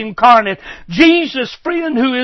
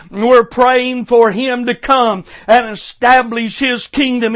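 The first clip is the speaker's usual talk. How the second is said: ordinary delivery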